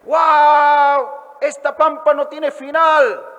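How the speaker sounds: high loud wow of wonder; amazed. Acted. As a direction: gasp at the endless plain.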